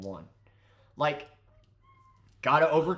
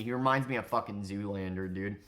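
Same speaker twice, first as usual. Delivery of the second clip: normal talking voice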